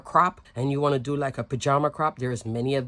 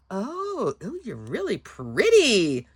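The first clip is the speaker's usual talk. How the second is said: admiring coo; 3 semitones higher, slower than usual. Retold or imitated. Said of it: "I was like".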